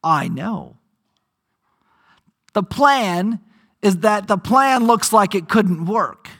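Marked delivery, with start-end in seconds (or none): 0.0-0.8 much lower, softer, knowing answer; God's calm reply. Acted. Pastor voicing God answering the doubters, low and calm. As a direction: answer quietly, unbothered, fully in control. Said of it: "what God says to that".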